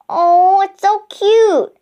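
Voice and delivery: high, loud, drawn-out coo; delighted high squeal of words; gushing. A narrator's reading. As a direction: gush over an adorable little animal. Girl gushes over little mouse.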